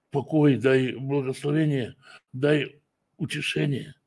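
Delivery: ordinary delivery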